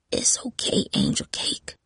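put-on soft, voiceless old grandmother's whisper; old woman whispering. A narrator's reading. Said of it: "Grandma whispered".

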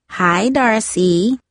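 slow, drawn-out singsong hello; singsong greeting. A narrator's reading. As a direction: sing out the greeting teasingly. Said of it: "Brasana sang out".

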